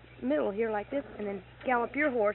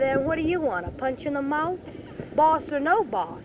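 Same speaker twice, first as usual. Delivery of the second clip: loud, higher, slowing on the last words; angry retort; 4 semitones higher, 7 dB louder than usual. Retold or imitated. Speaker threatening her boss, angry and loud. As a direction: snap back at him, ready to fight. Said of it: "I said".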